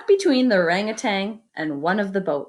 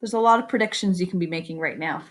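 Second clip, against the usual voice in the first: plain reading voice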